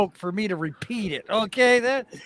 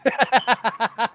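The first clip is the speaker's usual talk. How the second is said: helpless laughter; 3 semitones higher than usual. Real, not acted. Laughs again at the joke.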